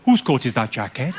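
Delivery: low Welsh lilt; warm-up phrase in Welsh; Welsh accent. Shown as a demonstration. Comic getting the accent started, low Welsh lilt. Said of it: "to get it started in my head".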